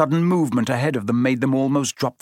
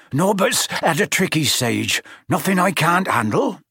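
lightly voiced flustered giant; Hagrid; 5 semitones higher than usual. A narrator's reading. Hagrid to the children, lightly voiced and flustered. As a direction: brush it off, a bit out of breath.

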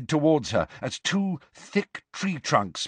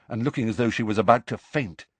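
usual speaking voice

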